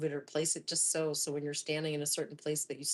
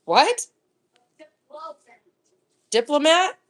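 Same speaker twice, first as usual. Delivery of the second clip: louder, rising puzzled shout; incredulous call; 9 semitones higher, 14 dB louder, slower than usual. Real, not acted. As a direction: call out in disbelief. Reacting loudly to child off-mic.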